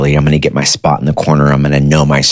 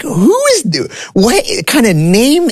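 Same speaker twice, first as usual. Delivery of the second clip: high, slow squeak of disbelief; retold shocked teen, much higher; stunned disbelief. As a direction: recoil at a name you've never heard. Learning his real name from mom.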